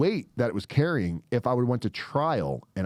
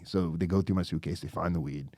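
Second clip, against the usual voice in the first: normal talking voice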